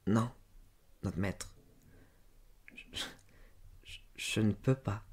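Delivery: soft, halting, half-whispered refusal; painfully articulated. A narrator's reading. Servant girl refusing her insistent master, painfully. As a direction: struggle to get each word out, barely voiced. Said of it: "elle articula péniblement".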